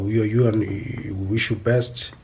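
ordinary talk, nothing marked

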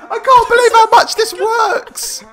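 high, loud, laughing in disbelief; hysterical laughing shout. Real, not acted. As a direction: crack up and yell with delight. Watching the clip, cracking up.

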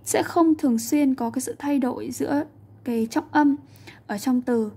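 usual speaking voice

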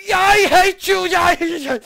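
high, loud screamed wail; hysterical rage. Real, not acted. Player cursing the game, hysterical scream. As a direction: scream it, close to wailing.

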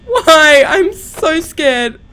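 ordinary delivery

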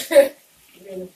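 loud laughing burst. Real, not acted. Laughing outburst at viewers.

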